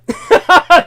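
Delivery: sudden high, loud burst of laughter. Real, not acted. Laughing while dismissing the old lady as unsympathetic. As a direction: laugh out loud at your own joke.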